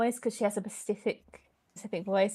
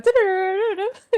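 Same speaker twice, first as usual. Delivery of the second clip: high loud mock music sting; dramatic sting; 9 semitones higher, 10 dB louder than usual. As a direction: belt out a cheesy sound effect. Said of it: "and then it just goes".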